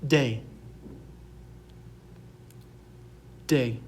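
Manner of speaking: De said DAY, falling, spaced apart; slow model pronunciation. Shown as a demonstration. Teacher has students repeat the letter after her, clear demonstration. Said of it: "Repeat after me".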